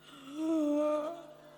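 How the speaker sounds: sharp, high startled intake of breath; loud gasp. Shown as a demonstration. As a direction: gasp loudly as if shocked. Sudden shock in the demo.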